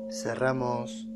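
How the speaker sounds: calm, measured phrases with long pauses; guided meditation. Acted. Guide leading listeners through breathing and relaxation, calm meditation voice. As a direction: lead the breathing unhurriedly, phrase by phrase.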